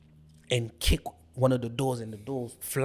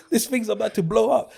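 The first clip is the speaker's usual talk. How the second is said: higher and laughing through it; laughing retelling; 9 semitones higher, 4 dB louder than usual. Retold or imitated. Retelling the rescue to his friend, laughing. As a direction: urge him on while cracking up. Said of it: "You're like".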